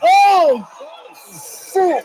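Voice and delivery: loud, high gasp of alarm; shocked outcry. Real, not acted. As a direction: cry out at a dangerous stunt. Dangerous move on the barricade.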